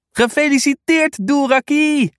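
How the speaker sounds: hoog, luid en opgetogen; enthousiast. Acted. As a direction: feliciteer het hondje vol blijdschap. Kind feliciteert jarige hond. (kind feliciteert de jarige hond uitgelaten)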